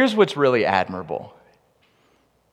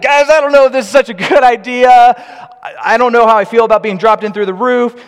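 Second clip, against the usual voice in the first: high, loud, half-joking protest from the stretcher; a nervous friend balking at the roof plan; protesting paralytic; 12 semitones higher, 12 dB louder than usual. Pretend. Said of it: "Pete might have even been protesting, like".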